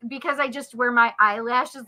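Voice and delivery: ordinary delivery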